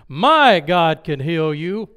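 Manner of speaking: loud, slow proclamation, first word drawn out; booming, stretched-out confidence; bold declaration. Retold or imitated. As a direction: declare it boldly at full voice. Boldly answers the woman's wish.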